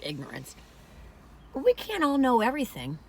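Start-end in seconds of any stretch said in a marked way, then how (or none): 0.0-0.6 "ignorance" dropped low and much softer; hushed like a bad word. Shown as a demonstration. Saying it like a bad word, low and hushed. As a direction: murmur "ignorance" as if it were a swear. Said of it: "like it's a bad word".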